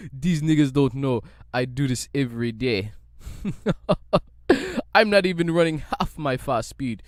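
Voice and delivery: mock Jamaican accent, loud and laughing; hammed-up Jamaican accent, chuckling. Bolt mocking the runners beside him, in a mock Jamaican accent. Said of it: "racist Jamaican, uh, accents".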